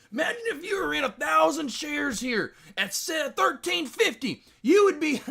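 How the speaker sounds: high, loud excited imitation with a laugh; mimicking Deck. Streamer mimics friend Deck dreaming of gains, high and excited. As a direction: crank up a gleeful mock Deck. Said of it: "Deck would always be like".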